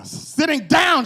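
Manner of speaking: high, loud, slow exclamation; shouted punchline. Acted. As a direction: belt it out as the joke lands. Punchline about legless grandma.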